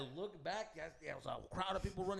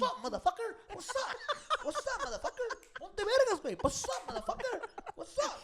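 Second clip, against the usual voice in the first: very high, loud, repeated taunting; squeaky hammed-up aggression; mock challenge; 7 dB louder than usual. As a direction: imitate a hyped-up little brawler yelling. Newcomer repeating his only insult.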